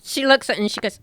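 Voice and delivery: normal talking voice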